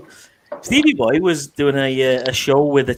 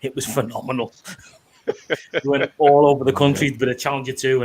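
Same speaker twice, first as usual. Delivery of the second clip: ordinary talk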